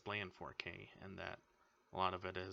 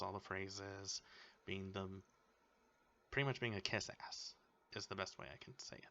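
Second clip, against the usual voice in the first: ordinary delivery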